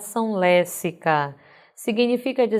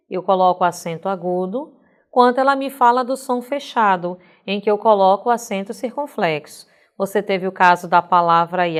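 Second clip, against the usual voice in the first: normal talking voice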